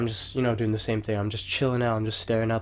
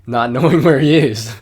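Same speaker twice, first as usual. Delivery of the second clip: louder, laughing through it; 2 semitones higher, 9 dB louder than usual. Real, not acted. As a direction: crack up mid sentence. Laughs mid line.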